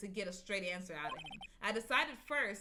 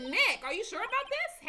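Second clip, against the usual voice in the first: higher-pitched worried sidekick; higher worried girl voice; 14 semitones higher, 9 dB louder than usual. Acted. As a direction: lift it up and fret a little. Voicing Maya in the game.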